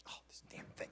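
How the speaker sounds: whispered annoyed aside under the breath; a quick hushed grumble at the equipment. Real, not acted. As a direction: whisper it off-mic, annoyed at the gear. Muttering aside at faulty mic.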